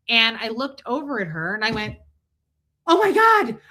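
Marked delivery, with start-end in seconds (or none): 2.8-3.6 loud, high, excited exclamation; excited outburst. Retold or imitated. Shopper spotting the bagger she remembers, excited outburst. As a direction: blurt it out with delighted energy. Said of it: "because I was all excited".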